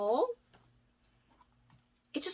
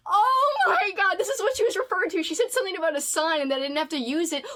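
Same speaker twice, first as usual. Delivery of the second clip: real excited gasp; 7 semitones higher, 5 dB louder, faster than usual. Real, not acted. Gasps opening surprise gift.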